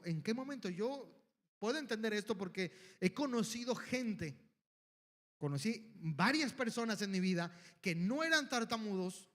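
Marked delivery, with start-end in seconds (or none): none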